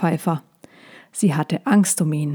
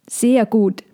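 plover's reply; 4 semitones higher than usual. A narrator's reading. Plover answers the squirrel.

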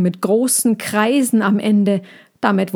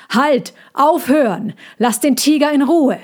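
louder, higher commanding shout; 7 semitones higher, 4 dB louder than usual. A narrator's reading. The chief orders the people to leave the tiger alone, loud. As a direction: order them all to stop at once.

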